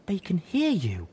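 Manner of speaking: ordinary delivery, nothing marked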